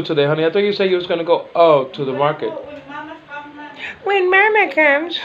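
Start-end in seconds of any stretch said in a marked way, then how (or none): 4.0-5.2 squeaky high imitation of the wife, slow; high mock wife voice. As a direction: mimic her answer in a tiny high voice. Mimicking wife's reply, high.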